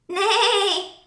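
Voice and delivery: high drawn-out neigh; whinny like a horse. A narrator's reading. The horse greeting the truck. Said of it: "said the horse".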